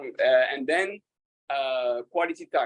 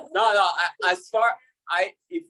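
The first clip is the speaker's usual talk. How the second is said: ordinary delivery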